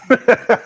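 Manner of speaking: short loud high burst of laughter. Real, not acted. Host reacting to viewer's comment, laughing loudly. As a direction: let out a quick, loud laugh.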